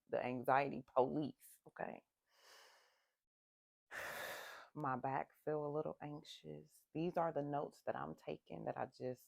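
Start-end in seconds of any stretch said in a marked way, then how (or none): 3.9-4.7 heavy sigh. Real, not acted. Noting her anxiety aloud.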